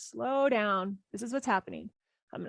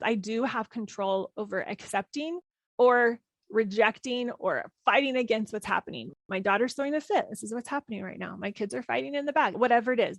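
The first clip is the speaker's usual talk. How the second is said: ordinary talk, nothing marked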